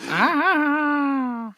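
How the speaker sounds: long, high-pitched "aah"; drawn-out high cry. Pretend. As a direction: hold one high, sustained "aah". Drawn-out cry before his segment.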